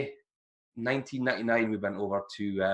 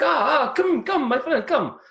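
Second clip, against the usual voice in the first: high, excited mock Italian chef; high eager chef calling a soldier over for steak; 14 semitones higher, 5 dB louder, slower than usual. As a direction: beckon him over like a beaming Italian chef. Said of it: "he's like".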